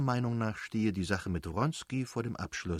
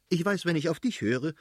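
high, exasperated retort; aggrieved wife's interruption; interrupting; 7 semitones higher, 4 dB louder than usual. A narrator's reading. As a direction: cut in with annoyance, pitch raised. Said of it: "unterbrach die Fürstin".

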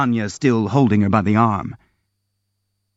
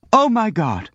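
high, horrified outcry; 11 semitones higher than usual. Acted. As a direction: cry out in shock. Kolya horrified after blow.

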